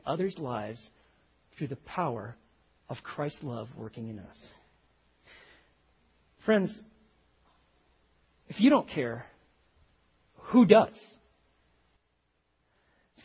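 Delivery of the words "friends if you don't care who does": loud ringing preacher's plea; preaching at full force. Real, not acted. Preacher challenging the congregation, loud and urgent. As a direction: call the church out, full voice.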